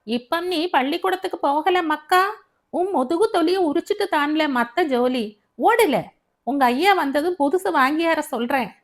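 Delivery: scolding mother. A narrator's reading. Mother scolds her son who won't go to school, loud and cross. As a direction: tell the crying boy off sharply. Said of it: "மகனை ஏசி தள்ளி கொண்டிருந்தாள்".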